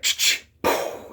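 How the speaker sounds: unvoiced clicks and a puff; imitated noise. Columbus hears a sound among candles, imitated noise. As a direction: mimic the noise with mouth sounds. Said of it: "there's a sound".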